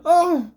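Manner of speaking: high, loud pained "oh"; hammed-up howl of dismay; mock dismayed wail. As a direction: cry out as if wounded. Imitates commentator's dismayed outburst.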